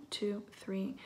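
soft whispered count; whispering. Real, not acted.

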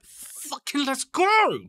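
high, loud, drawn-out battle cry; over-the-top aggressive psych-up shout; aggressive hype yell. Retold or imitated. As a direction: yell it slowly, building to a pumped-up roar. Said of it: "But not as aggressive".

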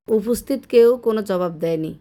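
ordinary delivery, nothing marked

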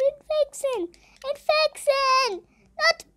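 high silly cartoon squeak; squeaky voice. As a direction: squeak the word, slow and high. Squeaky voice repeating the mode.

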